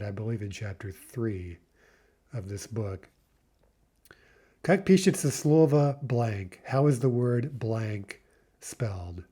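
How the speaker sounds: ordinary talk, nothing marked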